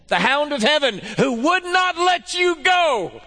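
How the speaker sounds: high, loud, driving pulpit voice; full-force preaching. Acted. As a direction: rouse the congregation. Full-force preaching, greeting congregation.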